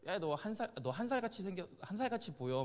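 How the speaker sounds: put-on high-pitched taunting kid voice; sing-song the older boy's teasing. Pastor voicing the ten-year-old teasing David, high taunt. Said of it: "막 이러면서 맨날 놀리고".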